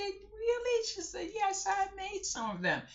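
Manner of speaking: put-on high excited little girl; high sweet child voice. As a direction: brag like a small girl. Child bracelet talk.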